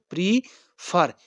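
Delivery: slow "prefer" with the second syllable fer pressed hard; stress on FER. Shown as a demonstration. Teacher shows students the stressed last syllable, slowly. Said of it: "इस पर हम दबाव देंगे, stress देंगे".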